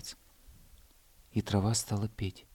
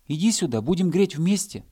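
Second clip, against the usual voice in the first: higher, louder invitation; calling out; 7 semitones higher, 5 dB louder than usual. Acted. Hare calling the bear cub down, loud. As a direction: call him down to join.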